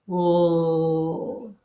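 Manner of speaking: a long held L with the tongue tip on the ridge; L sound demo. Shown as a demonstration. Teacher modelling a lone L sound for the class. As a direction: hold the L, tongue behind the top teeth. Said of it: "when you say the L sound".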